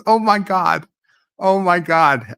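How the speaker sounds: can't believe what he just heard, delighted; oh my God, half laughing in disbelief; amused disbelief. Real, not acted. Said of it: "and it's hilarious".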